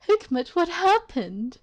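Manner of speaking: high-pitched acted questioning; improvised character voice. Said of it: "I don't even remember the voice I gave him".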